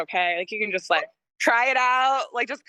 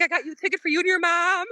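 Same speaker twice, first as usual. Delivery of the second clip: put-on Argentinian accent; 8 semitones higher, faster than usual. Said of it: "in his Argentinian accent".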